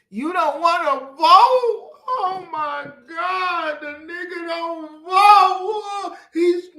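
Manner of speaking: high, loud, slowed wailing cry of disbelief; mock wailing outrage. Preacher mocking a man who won't vote, high wailing outrage. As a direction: wail it out like a shocked churchgoer.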